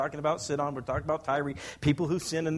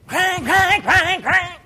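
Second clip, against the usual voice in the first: loud, high clanging strums; imitated guitar strumming; 14 dB louder, much slower than usual. Grandpa mimics grandchild banging on guitar, loud harsh strumming noise. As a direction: mimic a child thrashing a guitar. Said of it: "Strumming the guitar".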